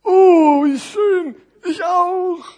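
high, loud, gushing character voice; exaggerated storybook delight; delighted big parcel. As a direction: coo it loudly, like a puppet show. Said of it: "sagte das dicke Päckchen".

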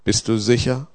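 ordinary delivery

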